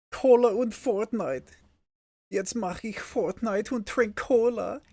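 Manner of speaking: high, put-on German kid voice; high-pitched mock glee. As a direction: pitch it way up like an excited child. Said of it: "That's as high as I can go".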